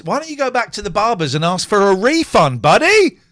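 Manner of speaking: loud, rising jeer; mocking heckle. Real, not acted. Heckling the actor.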